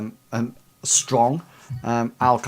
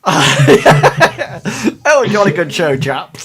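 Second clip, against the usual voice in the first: laughing; 6 semitones higher, 11 dB louder, slower than usual. Retold or imitated. Mock posh English banter, laughing.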